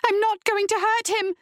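high-pitched defiant protest; bitten-out protest. A narrator's reading. Woman refuses the demons' urging, high bitten-out protest. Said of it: "she bit out".

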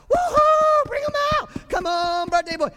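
very high, loud whooping cheer; mock cheering. Preacher mocking cheering at a birthday party, whooping high. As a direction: cheer like a crowd for the birthday boy. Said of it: "We don't cheer at a birthday party".

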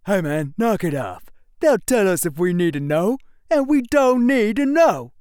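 a hint of a stern soldier; lightly voiced scolding recruit. Second recruit scolds friend.

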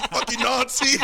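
very high laughing mock yell; mock shout. Retelling the Curb episode scene, with a laughing high yell. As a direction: shriek it through laughter. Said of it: "it's like".

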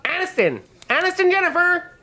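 very high, squeaky summons; gnome calling out. Acted. Gnome innkeeper calls a courtesan over, in a high squeal. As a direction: call for the staff in a high gnome voice. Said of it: "so the gnome calls".

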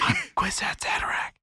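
intense mock stage whisper; whispering to themselves. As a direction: hiss it as a solemn inner revelation. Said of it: "everybody's whispering to themselves".

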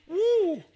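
sudden high laughing woo; laughing whoop of praise; joyful whoop. Real, not acted. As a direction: let out a release of joy. Joyful outburst after being overcome.

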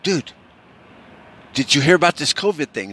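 a hint of urgent crackhead pal; excited friend. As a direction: lightly play a friend bursting with news. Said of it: "your friend's coming over".

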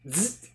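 short imitated zap; light electric buzz. Shown as a demonstration. Describing the electrocution sound that opens the film, light buzz. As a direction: make a small buzzing zzz. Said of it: "a very light, like".

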